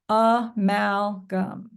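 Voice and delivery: Amalgam very slow, split as a, mal, gum; syllable by syllable. Shown as a demonstration. Teacher leading everybody in repeating the syllables, syllable by syllable. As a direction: stretch Amalgam out, one syllable at a time. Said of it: "let's repeat the syllables in order".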